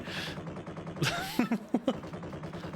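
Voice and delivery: breathy laugh. Real, not acted. Laughing at own impression.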